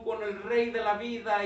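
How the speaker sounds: normal talking voice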